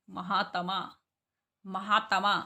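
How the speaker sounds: महात्मा as "ma-haa-ta-maa", four syllables; full-ta mispronunciation. Shown as a demonstration. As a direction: sound a full "ta" inside महात्मा. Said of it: "पूरा तय लगा दें तो हम इसको कैसे पढ़ेंगे?". (महात्मा में पूरा त: "महातमा")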